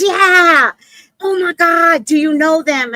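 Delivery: high, loud excited shout; hammed-up thrilled outburst; Flint amazed. Acting out Flint's excited discovery.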